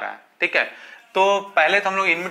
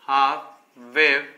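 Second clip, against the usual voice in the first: very slow dictation; 3 semitones lower, much slower than usual. Shown as a demonstration. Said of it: "teacher spells out term slowly".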